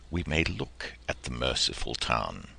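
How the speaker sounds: plain reading voice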